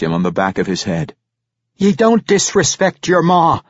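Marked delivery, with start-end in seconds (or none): 1.8-3.6 high-pitched scolding dwarf mother; indignant mother telling off her boy. A narrator's reading. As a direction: scold a disrespectful son, voice raised high. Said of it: "she scolded".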